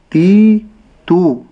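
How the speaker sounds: تي and تو stretched too long and loud; wrong lengthened ta. Shown as a demonstration. Teacher warns students against stretching the ta. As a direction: drag out تي and تو. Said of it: "انتبهوا ألا أقول".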